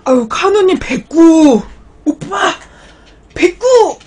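high, loud, drawn-out cry of delight; excited shout. Real, not acted. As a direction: shout the thanks with joy. Excited at a donation.

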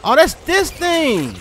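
high, loud, drawn-out outcry; alarmed realisation. Real, not acted. Player recognising the monster in game, alarmed. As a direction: voice leaping as it dawns.

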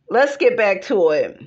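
ordinary talk, nothing marked

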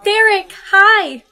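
high, loud and drawn-out hello; giddy greeting. Acted. Girl greeting the man she hopes is her soulmate, giddy hello. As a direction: gush over seeing him.

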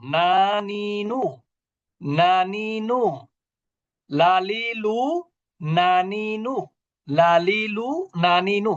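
slow, stretched নানিনু and লালিলু; drawn-out letter drill. Shown as a demonstration. Teacher drilling pupils in Bangla-like n and l, drawn out. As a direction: chant নানিনু, লালিলু with long vowels and plain dental sounds. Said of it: "দন্ত ন এর উচ্চারণ".

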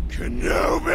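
high, rasping villain scream; Darth Maul. Acted. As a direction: screech the name like a villain. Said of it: "that's my impersonation of the clip".

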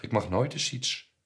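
put-on high, loud teenage protest; shouting back. A narrator's reading. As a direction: yell back defiantly at mother. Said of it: "schreeuwde ik terug". (opstandig terugschreeuwen)

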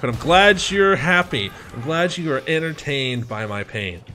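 sarcastic. Retold or imitated. Prank victim telling friends he's glad they enjoyed it, sarcastic.

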